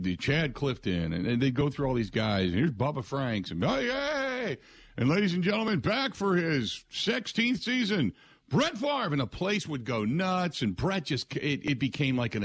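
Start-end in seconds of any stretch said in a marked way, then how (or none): 3.6-4.6 mock fan cheer. Crowd cheering players at Fan Fest. 5.0-9.1 stadium announcer. Retold or imitated. Stadium announcer introduces Favre.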